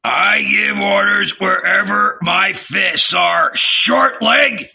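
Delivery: high, forceful threat; angry retort. A narrator's reading. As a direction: throw back a scornful threat. Judas retorts in a quarrel.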